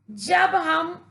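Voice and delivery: ordinary talk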